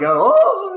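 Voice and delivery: high, loud "oh" of a jolted rider; mock startled yell. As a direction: yelp as if the ride jerks you. Imitates being jerked around on ride.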